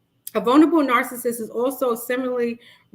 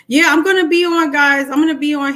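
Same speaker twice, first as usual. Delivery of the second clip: excited; 5 semitones higher, 8 dB louder than usual. Real, not acted. Excited announcement to viewers.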